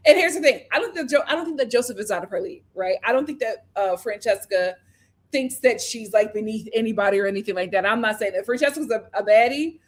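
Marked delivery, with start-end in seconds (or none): none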